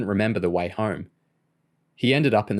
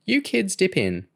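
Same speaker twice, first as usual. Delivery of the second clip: put-on old lady voice; 11 semitones higher than usual. A narrator's reading. Old neighbour lady offers biscuits.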